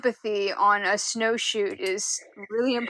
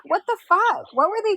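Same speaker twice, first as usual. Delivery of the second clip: outraged disbelief; 10 semitones higher than usual. Real, not acted. Friend outraged at story.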